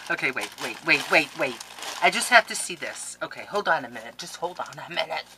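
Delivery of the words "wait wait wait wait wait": ordinary talk, nothing marked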